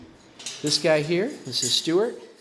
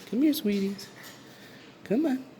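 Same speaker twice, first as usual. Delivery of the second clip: much higher coaxing call to the parrots; high pet-talk call; 13 semitones higher than usual. Real, not acted. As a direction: lure the birds sweetly. Calling birds to come out.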